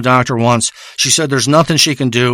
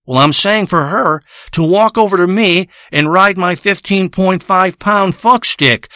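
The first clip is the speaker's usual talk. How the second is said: ordinary talk, nothing marked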